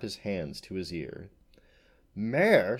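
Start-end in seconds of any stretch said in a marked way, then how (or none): 2.1-2.8 loud, raised, affronted question; indignant demand. A narrator's reading. Unicorn demanding an answer from the farmer, indignant. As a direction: demand it, offended. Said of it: "she demanded".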